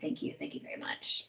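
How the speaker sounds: breathy whispered thank you. Retold or imitated. Thanking Steve Riddle for praise, in a mock-humble whisper. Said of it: "and I'm like".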